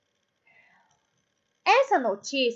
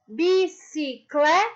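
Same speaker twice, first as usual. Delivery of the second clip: "bicicleta" slowly split, rising to a strong CLE, then TA; syllable by syllable; 3 semitones higher, slower than usual. Shown as a demonstration. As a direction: draw out each syllable of "bicicleta", stressing CLE. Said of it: "Isso no clé".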